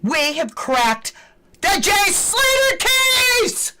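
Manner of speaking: high, loud, slow final shout; triumphant shout. Real, not acted. Vlogger to viewers on cracking the case, triumphant shout. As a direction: belt it out like a winner.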